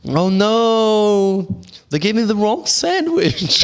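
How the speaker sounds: high, long-drawn mock dismay; meme customer's wail. Meme customer discovers a wrong sandwich, high exaggerated wail. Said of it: "Eine bestellt sich so".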